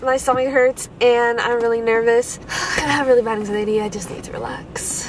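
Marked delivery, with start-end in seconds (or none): 3.8-5.1 whispered to herself. Real, not acted. Nervous wife calming herself.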